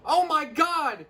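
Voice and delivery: high, loud, drawn-out excited cry; imitating a thrilled superfan; stoked fanboy. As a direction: squeal it like a starstruck fan. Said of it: "He is stoked about it".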